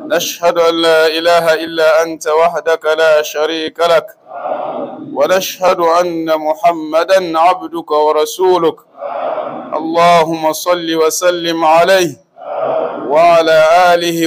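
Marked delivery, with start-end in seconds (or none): none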